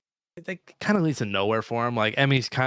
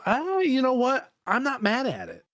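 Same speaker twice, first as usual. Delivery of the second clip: high-pitched mock acceptance; a playful squeak of giving in; 12 semitones higher than usual. Real, not acted. Sudden change of mind, high voice.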